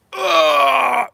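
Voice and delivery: sudden high shout, very loud; high yell. Pretend. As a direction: yell it out like a roaring boxer. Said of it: "Here's my impression of Mike, Mike Tyson".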